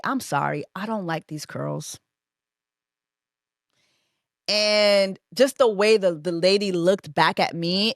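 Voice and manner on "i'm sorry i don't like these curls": soft but firm, a little lower; quiet firm complaint. Retold or imitated. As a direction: say it low and polite, yet unbending. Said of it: "I say".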